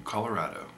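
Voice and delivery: "Colorado" with a broad "rah" in the third syllable; -rah-do ending. Shown as a demonstration. Vlogger naming the state they are moving to, rah-do pronunciation. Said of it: "How do you say it?".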